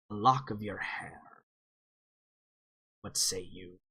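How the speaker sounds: a sly elder weighing his price; old dwarf voice. Acted. GM's dwarf quietly asking the cleric for a price. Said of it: "and he says".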